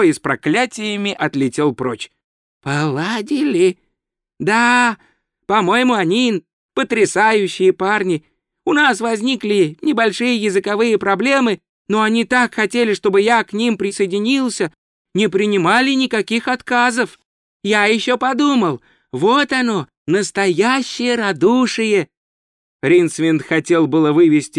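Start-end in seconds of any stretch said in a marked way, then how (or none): 2.6-3.8 slow, rising echo question; stunned. Acted. Wizard stops short at tourist's words, stunned slow question. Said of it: "Ринсвинд потрясённо остановился". 4.4-22.1 high, eager tourist voice; naive tourist, admiring. Acted. Tourist praises thugs to wizard, high naive admiration. Tourist tells of his new friends.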